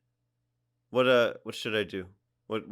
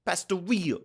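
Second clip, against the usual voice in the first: Pastoria said higher as pas-to-RI-o; hammed-up Italian Pastoria; Italian-ish accent; 7 semitones higher than usual. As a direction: lean harder into the Italian Pastoria. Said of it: "I'm going back to Italian".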